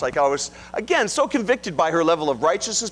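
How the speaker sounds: normal talking voice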